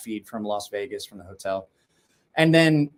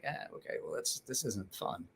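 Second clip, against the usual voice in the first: soft breathy mumbled imitation, much softer; imitating father-in-law; 15 dB quieter than usual. Retelling the father-in-law's Vegas win, imitated much softer. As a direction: hush it like an underwhelmed old man. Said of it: "He's like".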